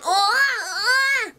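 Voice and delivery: high, drawn-out "oh, ah" wail; flustered wailing. Acted. Acting out Ash dodging mistletoe, flustered wail.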